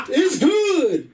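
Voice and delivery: high-pitched gloating announcer cry; triumphant score call. Acted. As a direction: crow it like a field goal just landed. Mimics a referee's score call to gloat.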